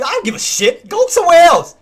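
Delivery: high, loud yell to send doubters away; angry shout. Real, not acted. Streamer yelling at viewers who want to leave, angry. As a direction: bark them out the door, furious. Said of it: "sorry about the rant".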